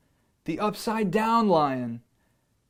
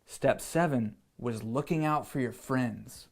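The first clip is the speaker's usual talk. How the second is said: ordinary delivery, nothing marked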